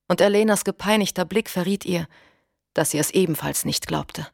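usual speaking voice

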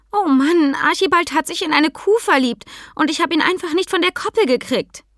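put-on high-pitched, flustered little girl calling out; hectic. A narrator's reading. Tinka to Sam about her runaway pony, hectic. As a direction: blurt it out in a high, frantic rush. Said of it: "rief sie hektisch".

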